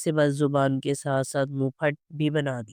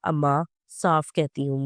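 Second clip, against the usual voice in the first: usual speaking voice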